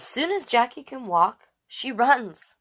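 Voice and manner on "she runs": ordinary delivery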